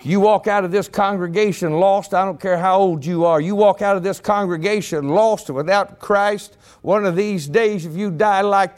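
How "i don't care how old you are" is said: ordinary delivery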